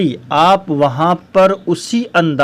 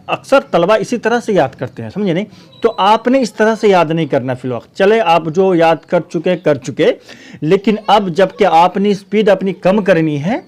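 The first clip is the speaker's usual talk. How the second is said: usual speaking voice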